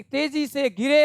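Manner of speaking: ordinary delivery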